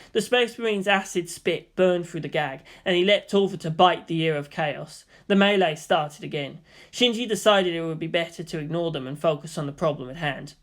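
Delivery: plain reading voice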